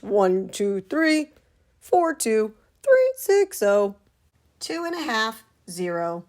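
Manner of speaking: silly squeaky marching count. Acted. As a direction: bounce through the count like a clown.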